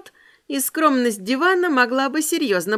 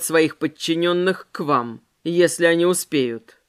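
composed elegant vampire, lower; calm lower man's voice; 6 semitones lower than usual. Acted. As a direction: speak low and calm like a gentleman.

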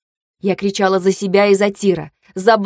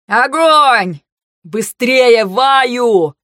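raised voice, rallying to fire; shouted battle command; 3 semitones higher, 4 dB louder, much slower than usual. A narrator's reading. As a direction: drive your team on at a shout.

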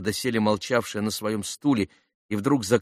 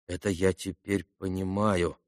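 soft, thoughtful repetition; quietly pondering; musing; 2 semitones lower, 7 dB quieter than usual. A narrator's reading. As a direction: say it softly, thinking it over. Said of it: "раздумывая".

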